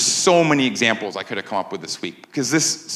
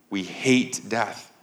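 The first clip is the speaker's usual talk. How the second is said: ordinary talk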